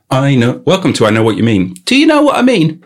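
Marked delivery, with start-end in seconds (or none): 1.8-2.8 much higher and louder; high-pitched questioning. Pretend. Riffing on the phrase, high-pitched questioning. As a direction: squeal the question, hammed-up. Same question repeated in varied ways.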